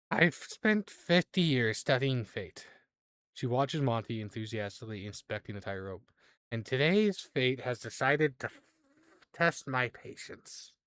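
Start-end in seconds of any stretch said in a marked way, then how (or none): 0.1-2.7 loud, slow fortune teller's complaint; hammed-up grumble. Fortune teller catching up to the group, grumbling loudly. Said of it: "mutters under her breath". 6.5-10.7 louder, slowing fortune teller's complaint; hammed-up grumble. Fortune teller watching Monty inspect the rope, grumbling. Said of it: "mutters under her breath".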